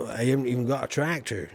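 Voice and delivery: ordinary talk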